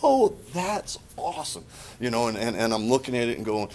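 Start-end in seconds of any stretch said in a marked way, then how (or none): 0.0-1.7 bright, high, delighted exclamation; sudden joyful discovery; excited aha. Retold or imitated. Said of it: "you're just like".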